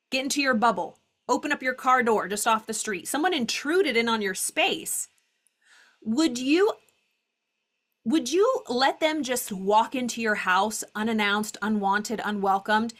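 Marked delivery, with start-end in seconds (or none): none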